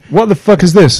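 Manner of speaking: loud, high, baffled outburst; exasperated shout. Real, not acted. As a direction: yell in disbelief at the gadget. Voice command keeps failing.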